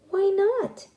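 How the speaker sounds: squeaky high frog voice, eager and bright. Acted. Frogs answering the rooster, high and eager. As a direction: pop it up high like a little frog.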